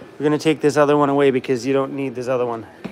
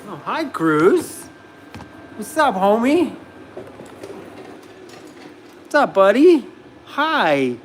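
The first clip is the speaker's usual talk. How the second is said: playful greeting to a pet, higher swoops; dog-greeting voice; 5 semitones higher, 3 dB louder, slower than usual. Real, not acted. Owner greets his dog on camera, playful pet-talk. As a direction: greet your dog with lifted, lilting affection.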